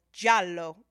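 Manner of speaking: Italian accent on the film term; playful attempt at an Italian accent. Shown as a demonstration. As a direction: say it with your best Italian accent. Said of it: "That's my best Italian accent".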